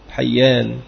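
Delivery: حيان as ḥayyān, fatha on ح and a doubled y; careful pronunciation. Shown as a demonstration. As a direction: say حيان with ḥa and a held yy. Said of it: "بفتح المهملة وبالياء المثنات التحتية". (حَيّان بفتح الحاء وتشديد الياء)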